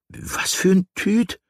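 high, slow, puzzled question; flabbergasted aunt, drawn-out and high; baffled. A narrator's reading. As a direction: ask it high-pitched in astonishment. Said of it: "fragte Tante Paula verblüfft".

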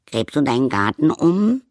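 high, bright curious question; high little creature voice. Acted. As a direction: ask it like a tiny playful sprite. Light dancing creature asks bear.

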